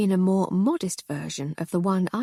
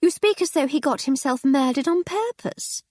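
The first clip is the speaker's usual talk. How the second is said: higher, scolding lady voice; reproachful lady; 8 semitones higher, 3 dB louder than usual. Acted. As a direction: tut at her husband. Wife scolds drunk husband.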